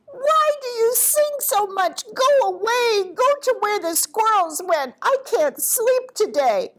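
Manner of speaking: high-pitched, fussed owl complaint; agitated owl. A narrator's reading. Owl complains to singing birds, high agitated voice. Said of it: "very agitated".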